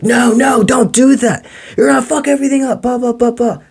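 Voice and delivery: shrill-free squeaky panic of the inner critic, rushed; nagging parasite voice. Retold or imitated. Mocking the inner parasite voice warning them off. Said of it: "That's your parasite".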